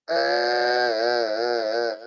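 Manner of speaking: long, loud pretend wail; hammed-up noisy sobbing; mock loud crying. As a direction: bawl theatrically on one held cry. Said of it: "nangis kencang-kencang".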